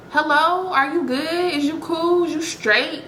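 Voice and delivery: high, sarcastic checking in; sassy mock concern. Mocking the man who hid his sexuality, high sassy concern. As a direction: needle him with fake concern.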